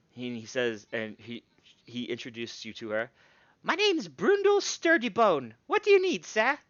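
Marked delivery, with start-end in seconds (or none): 3.6-6.6 gnome smith voice. Acted. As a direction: boom it out high and proud.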